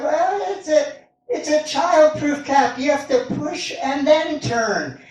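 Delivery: yelling wife. Retold or imitated. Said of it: "They yell at each other from across".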